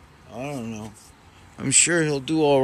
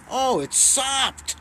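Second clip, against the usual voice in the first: surprised; 10 semitones higher, slower than usual. Real, not acted. Finds the soaked bed.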